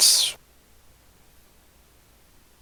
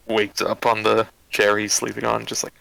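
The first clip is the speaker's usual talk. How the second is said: ordinary delivery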